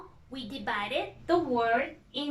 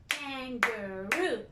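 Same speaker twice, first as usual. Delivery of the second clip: kan-ga-roo drawn out slowly by syllable; slow syllable beats; 5 dB quieter, slower than usual. Shown as a demonstration. Teacher tapping kangaroo out with the hand, slowly. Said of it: "Let's clap".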